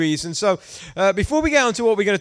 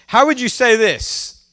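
ordinary talk

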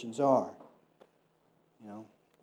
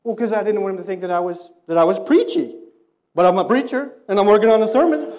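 higher, louder, hurried self-justification; defensive; 6 semitones higher, 11 dB louder than usual. Retold or imitated. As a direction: protest your excuse a bit too loudly. Sheepish self-defense to friend.

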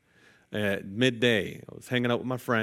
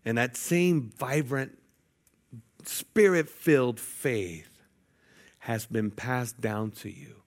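slow emphasis; slower than usual. Real, not acted. Preacher emphasizes inherited faith.

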